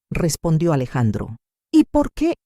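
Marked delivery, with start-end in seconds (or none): 1.7-2.3 very high questioning character voice; voiced Camila. A narrator's reading. Camila pressing the lawyer for his reason, high character voice. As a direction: pitch it far up for her question.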